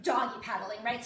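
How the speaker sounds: ordinary delivery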